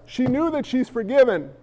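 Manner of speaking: ordinary talk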